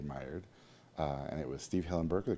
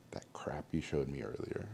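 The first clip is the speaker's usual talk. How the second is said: whisper; 4 semitones lower, 6 dB quieter than usual. Retold or imitated. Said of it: "whispered aside in quote".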